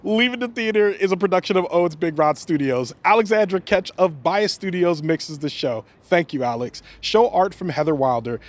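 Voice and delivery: upbeat podcast credits read; outro read. Acted. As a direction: rattle off the credits. Reads show credits.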